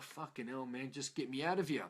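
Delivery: low, soft, fed-up inner voice; grumbling aside. Retold or imitated. Newcomer to himself among happy people, fed-up grumble. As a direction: grumble it under the breath, low and weary.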